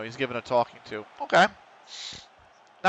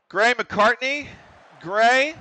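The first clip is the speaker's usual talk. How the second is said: calling out; 10 semitones higher, 8 dB louder, much slower than usual. Real, not acted. Calling players during play.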